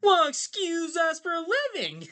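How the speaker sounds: very high, indignant cartoon squawk; penguin voice. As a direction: squeak it out like an offended penguin. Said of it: "the penguins were like".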